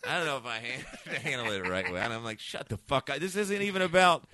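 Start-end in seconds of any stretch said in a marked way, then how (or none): 2.4-4.2 high, loud exasperated comeback; retold angry retort. Comedian retelling his reply to an offended woman, exasperated. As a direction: snap back at a mistaken heckler.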